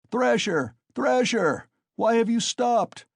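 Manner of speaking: high, loud goading shouts; crowd shouting. A narrator's reading. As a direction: goad him on at high pitch. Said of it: "shouted voices in the crowd".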